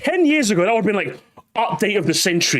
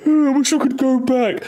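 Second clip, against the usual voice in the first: higher, whiny pining voice; put-on pining for the old days; mock nostalgic wail; 6 semitones higher than usual. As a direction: overdo the wistful longing. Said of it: "I don't miss it in a".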